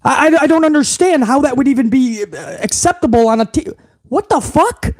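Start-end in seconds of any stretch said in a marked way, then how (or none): 4.0-4.9 disbelief. Real, not acted. Indignant outburst at the segment.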